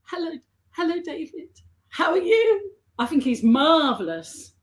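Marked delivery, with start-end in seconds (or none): 0.0-2.7 coy fantasy greeting. Pretend. Fan imagining greeting her idol across the room, coy. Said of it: "I fantasize, like, I'm on the other side of the room and I go".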